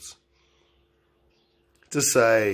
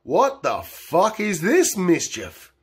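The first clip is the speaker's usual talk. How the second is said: higher, slow mock officer; mock cop voice; 7 semitones higher, slower than usual. A cop taunting a drunk driver, mock voice. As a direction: drawl it like a cocky cop. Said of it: "She's like".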